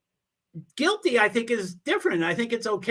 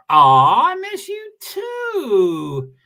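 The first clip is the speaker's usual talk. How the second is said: high, slow, hammed-up tearful whine; playful fake sob of missing a friend; mock crying; 7 semitones higher, 7 dB louder, much slower than usual. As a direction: stretch it out like you're about to cry. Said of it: "Am I doing voice inflections?".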